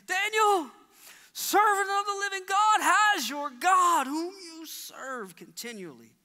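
high, loud anguished calling out; lamenting cry. Acted. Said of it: "cried out with a lamenting voice".

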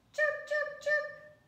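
very high chirping cheeps; bird chirp. Acted. Bird answering the child, high chirps. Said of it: "sang the bird".

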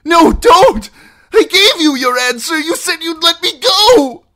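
very high, loud frantic protest; loud squealing. A narrator's reading. Captive begging outlaws not to hang him, squealing. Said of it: "Merritt squealed loudly".

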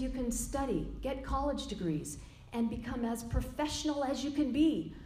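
Yogi Bhajan booming voice. Retold or imitated. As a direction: proclaim it like the teacher, a hint of his accent. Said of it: "his booming voice and Indian accent".

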